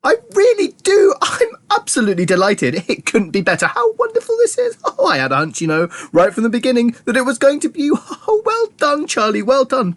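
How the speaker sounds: high, gushing delight; thrilled, tumbling high-pitched chatter; bursting with excitement. A narrator's reading. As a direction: gush on, pitch swooping high. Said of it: "suddenly exploded with excitement".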